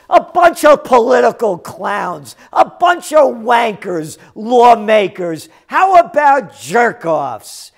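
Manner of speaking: loud, hammering insults; furious ranting at lawmakers; angry rant. Real, not acted. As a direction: shout the insults with contempt. Ranting at lawmakers, name-calling.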